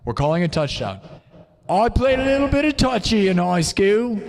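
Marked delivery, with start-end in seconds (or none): 1.7-4.2 mock British voice. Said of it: "they call it touchy".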